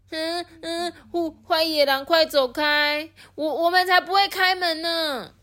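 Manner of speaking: high, stammering scared piglet; high, quavering piglet voice; trembling reply. Acted. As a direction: stutter bravely while shaking. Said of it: "发着抖回答".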